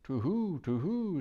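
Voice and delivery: high hooting owl. Acted. Owl startled by Aslan's name, hooting high. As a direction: hoot it out twice.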